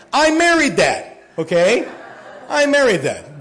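half-laughing about his Greek wife; laughing exclamation. Real, not acted. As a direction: exclaim it with delighted disbelief. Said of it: "laughing about his Greek wife".

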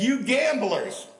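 slow, loud, high preacher's cry; preacher's shout. Retold or imitated. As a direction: call out the gamblers from the pulpit. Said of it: "Billy Sunday got in the pulpit".

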